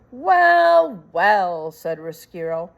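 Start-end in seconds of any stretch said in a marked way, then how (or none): 0.1-1.7 a sly, drawn-out well; mock the girl lightly as a rat; gloating rat.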